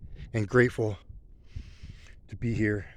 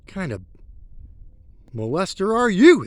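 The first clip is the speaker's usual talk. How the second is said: mock accuser; 6 semitones higher, 3 dB louder than usual. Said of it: "they said".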